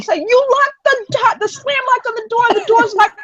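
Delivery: high and strained, slowed with disbelief; panicked explaining. Retold or imitated. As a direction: spell out the disaster in a panic. Explaining lockout to sister, panicking.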